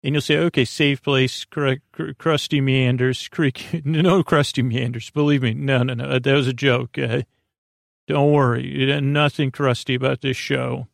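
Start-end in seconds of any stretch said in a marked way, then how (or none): none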